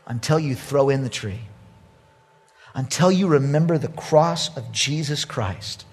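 usual speaking voice

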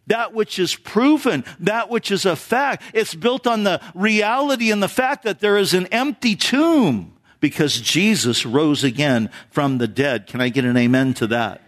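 ordinary talk

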